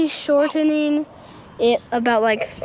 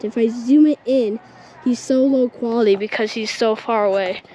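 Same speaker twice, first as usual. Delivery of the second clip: normal talking voice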